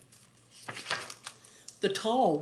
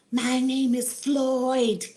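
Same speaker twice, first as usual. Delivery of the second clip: slow, higher snake voice introducing himself; snake Floyd; 7 semitones higher, slower than usual. Acted. Snake greets wizard, slow and high. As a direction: Floyd the snake says his name slowly. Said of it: "he said".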